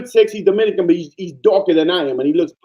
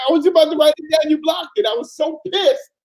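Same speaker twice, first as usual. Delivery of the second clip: teasing the viewer who blocked him, mock angry; mock outrage; 11 semitones higher than usual. Real, not acted.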